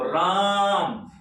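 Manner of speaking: राम very long, with a strong रा; stress on राम. Shown as a demonstration. Teacher giving an example of letter stress, drawn-out stressed राम. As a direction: hold the vowel of राम, pressing on रा. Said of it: "राम पर ज्यादा जोर पड़ा ना".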